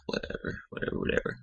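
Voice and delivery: dismissive whispered whatever; unvoiced whisper. Narrator dismissing Jess in a breathy whisper. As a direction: brush it off under your breath.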